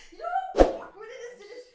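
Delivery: emotional outburst. Real, not acted. Defending herself, emotional.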